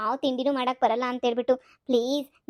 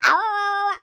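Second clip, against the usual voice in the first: shocked cry; 6 semitones higher, much slower than usual. Acted. Mother shocked at son.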